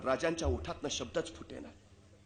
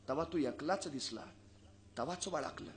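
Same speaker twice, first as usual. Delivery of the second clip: quiet mother; 9 dB quieter than usual. Acted. Mother quietly reveals she knew.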